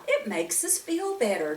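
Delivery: ordinary delivery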